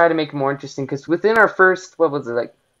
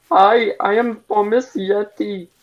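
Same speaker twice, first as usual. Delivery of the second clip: slow, higher put-on German voice; mock German accent; 6 semitones higher than usual. Player posing as Thomas Yeti, slow mock German accent. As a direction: introduce yourself in a hammed-up German accent. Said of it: "in that kind of voice".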